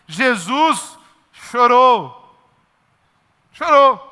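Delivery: stretched out very slowly; slow solemn. Acted. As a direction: let each word hang, slow and grave. Solemn key verse quoted.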